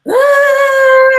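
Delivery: gasp, then a loud high drawn-out wail; mock wail, breath sucked in first; re-enacted crying. Telling how her son's father upset her, mock crying. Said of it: "because I cried".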